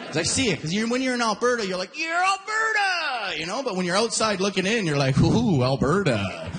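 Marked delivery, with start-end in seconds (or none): 2.0-3.4 high, drawn-out hollered cheer on ALBERTA; mock proud cheer. 5.2-6.5 slow, lower-pitched wary "Ooh"; mock dismay. Outsider looking in at Alberta.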